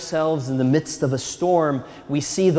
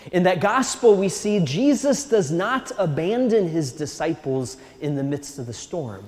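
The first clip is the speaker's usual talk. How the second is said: usual speaking voice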